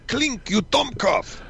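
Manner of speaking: slow, high mock German accent. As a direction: bark it like a hammy German officer. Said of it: "all he could say was".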